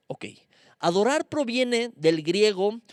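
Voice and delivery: usual speaking voice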